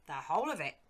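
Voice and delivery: put-on an eager old servant calling out; old servant William. A narrator's reading.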